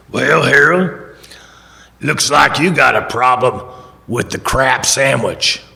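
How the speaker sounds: slow, loud B B King-style voice. Retold or imitated. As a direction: draw it out like an old bluesman chairing a meeting. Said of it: "It's what he sounded like".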